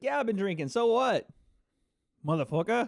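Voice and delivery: badly faked British accent, defiant; terrible British accent. Fighter answering a rival about drinking, mock British accent. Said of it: "was a terrible British accent".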